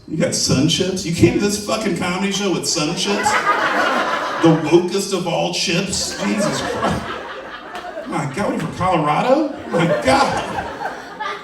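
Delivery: comic disbelief at a front-row fan's chips; mock outrage. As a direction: act appalled at the sun chips, hamming it up. Mock outrage at audience snacks.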